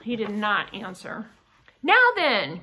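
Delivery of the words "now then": raised, higher call; loud cry. A narrator's reading. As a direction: call out sharply to get his attention. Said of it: "cried his father loudly".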